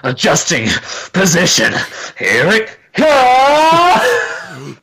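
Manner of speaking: drawn-out shout on "comes"; Dragon Ball Z yelling. Pretend. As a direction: yell it like an anime fight. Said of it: "just, like, yelling really loud".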